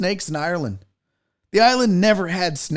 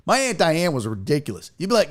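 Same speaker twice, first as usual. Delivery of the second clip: ordinary talk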